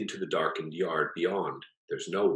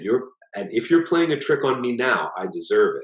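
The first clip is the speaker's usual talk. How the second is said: nervous apology; 6 semitones higher, 6 dB louder than usual. A narrator's reading.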